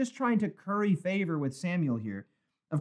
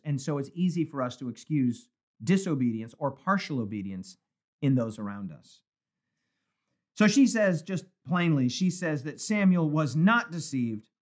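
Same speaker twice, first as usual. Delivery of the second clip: ordinary delivery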